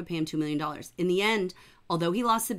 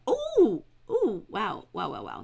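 startled ooh and wows; slower than usual. Real, not acted. Teacher fumbling with the camera, startled then deflating. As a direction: caught off guard by the camera.

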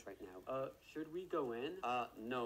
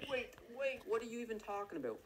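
high mock protest; 8 semitones higher than usual. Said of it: "He said".

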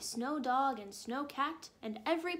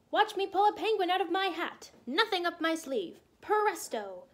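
high, loud showman's patter; stage magician; 6 semitones higher, 8 dB louder than usual. A narrator's reading. Snowman practicing a magic trick, showy magician. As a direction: announce the trick to a crowd.

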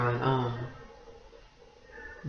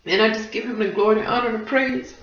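high, rising praise near tears; tearful praise; 5 semitones higher than usual. Real, not acted. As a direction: lift him up with a breaking voice.

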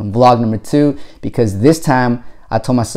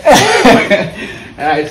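laughing; 11 semitones higher, 5 dB louder than usual. Real, not acted. Laughing at himself.

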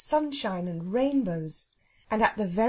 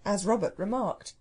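plain reading voice

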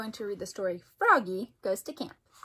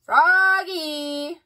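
loud, higher call; 5 semitones higher, 6 dB louder than usual. A narrator's reading. Director calling Froggy at the lake, loud. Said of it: "called Mister Mugwort".

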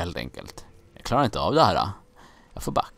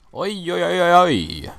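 mock character cry; 9 semitones higher, 8 dB louder, slower than usual. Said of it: "säger han".

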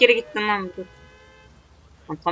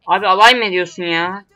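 loud exasperated complaint; 8 dB louder than usual. Real, not acted. Frustrated at game character.

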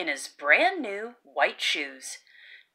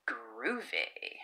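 soft aside; 10 semitones lower, 18 dB quieter than usual. A narrator's reading. Cool aside after narration.

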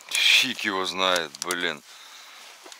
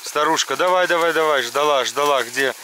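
raised, eager coaxing voice; talking to a dog; 6 semitones higher, 4 dB louder than usual. Real, not acted. Coaxing old dog over.

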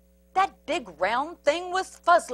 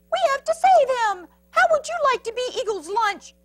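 high, loud urgent rallying; high, pleading little leader; Pip yelling; 11 semitones higher, 7 dB louder, faster than usual. A narrator's reading. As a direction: yell high to shame them into helping. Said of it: "yelled Pip".

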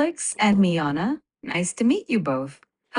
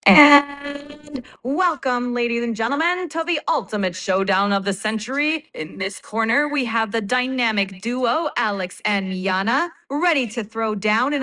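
booming ringside announcer; sportscaster voice; 3 semitones higher than usual. Pretend. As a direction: hype it like a boxing announcer. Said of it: "sound like a sportscaster".

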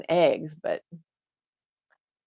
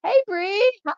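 much higher playful squeak; squeaky voice; 3 dB quieter, slower than usual. Pretend. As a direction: chirp it like a cartoon bird. High playful voice.

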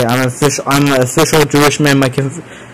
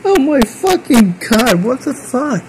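high, slow, stunned cry of disbelief; shocked disbelief; 11 semitones higher, slower than usual. Real, not acted. Streamer reacting to a wild viewer confession, high stunned disbelief. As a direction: drag it out in total shock.

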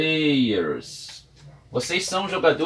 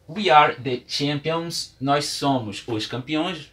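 slow model reading; slower than usual. Shown as a demonstration. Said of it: "Só lembrar daquela música".

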